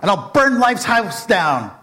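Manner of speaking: building from flat threat to a loud, high, hammed-up rage; villain vows revenge, building to a loud mock-furious shout; furious rant. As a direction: let the fury climb into a comic tantrum. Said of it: "Oh, he was furious".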